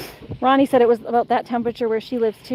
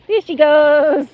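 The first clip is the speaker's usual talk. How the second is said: excited cheer; 3 semitones higher, 4 dB louder, much slower than usual. Real, not acted. Cheering dog running.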